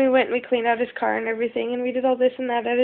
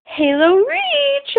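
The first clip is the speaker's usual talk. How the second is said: high and slow, drawn out; thrilled reveal; 14 semitones higher, 5 dB louder, much slower than usual. Retold or imitated. Retelling Kyle's surprise gift, thrilled and drawn out. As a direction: savor the name like the best gift ever.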